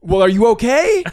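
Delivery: high, loud imitated call; yelling back. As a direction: holler the question as if to someone far off. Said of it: "yeah, I yell back".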